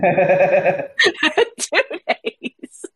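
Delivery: laughing through the words; amused, laughing repeat; laughing disbelief. Real, not acted.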